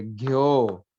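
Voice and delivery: घ्यो low and throaty; deep low घ्यो with a tonal drop; Punjabi ghyo. Shown as a demonstration. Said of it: "इसको ऐसे लिखेंगे".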